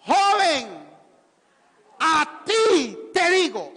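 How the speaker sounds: slow, loud, high proclamation; proclaiming shout. Real, not acted. Preacher reading Jesus' words with the church, slow shout. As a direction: shout the words out slowly to the crowd. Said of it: "Lean conmigo, fuerte".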